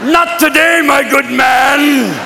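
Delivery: high, drawn-out haughty refusal; mock-gallant high call; taunting refusal. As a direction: refuse grandly, stretching the last words. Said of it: "It's like".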